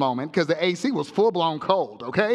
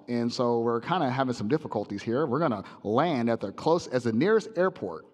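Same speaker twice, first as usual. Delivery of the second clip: low, muffled captain on the intercom; pilot voice; 9 semitones lower, 5 dB quieter than usual. Retold or imitated. Preacher mimics the pilot to the congregation, low intercom mumble.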